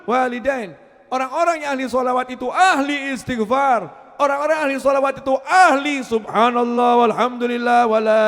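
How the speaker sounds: loud, high, building litany; preaching at full force. Acted. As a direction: roll the list out, bigger each line. (ceramah lantang yang terus memuncak)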